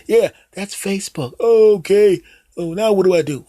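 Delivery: put-on high, hesitant old dad voice; clueless dad. Dad calling his son Jim for phone help, high and unsure. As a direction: play the fumbling father asking for help. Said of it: "You go up to them".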